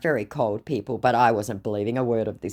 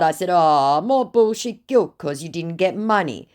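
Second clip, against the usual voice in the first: ordinary talk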